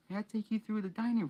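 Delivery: high, sugary put-on voice; simpering Dudley. A narrator's reading. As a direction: simper it like a spoiled boy. Dudley voiced in read story.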